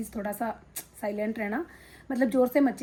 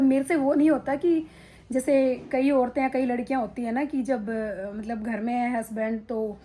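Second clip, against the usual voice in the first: usual speaking voice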